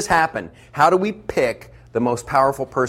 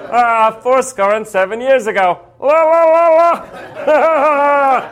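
much higher, louder squeaky voice ending in a yelp; high-pitched Lincoln; 9 semitones higher, 5 dB louder than usual. Retold or imitated. Comic imitating Lincoln's high voice in a debate, squeaky and loud. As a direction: squeak it out like a reedy old statesman. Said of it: "high-pitched, nasally voice".

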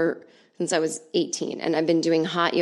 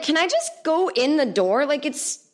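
exasperated plea; 9 semitones higher than usual. Retold or imitated.

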